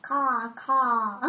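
bird cawing. Acted. Creature answers party.

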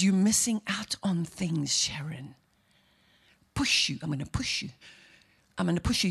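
low, hushed insinuating Satan; tempting Satan. Acted. Voicing Satan's temptation to her.

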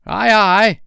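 very high, loud pirate acknowledgement; shrill sailor's shout; obedient sailor's reply. A narrator's reading. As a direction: sing out compliance to the captain. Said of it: "Smee said".

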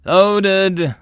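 high, loud crew call; drawn-out high battlefield shout; yelled. A narrator's reading. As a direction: yell it back to the gunner. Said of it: "the assistant yelled".

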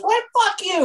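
imitating a furious drunk girl hitting back; mock angry drunk girl. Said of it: "she looks at me, she goes".

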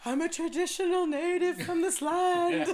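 slow mock solemn voice with a laugh under it; mock sob story. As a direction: play up the noble-native cliché, tongue in cheek. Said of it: "I got a s-, good sob story".

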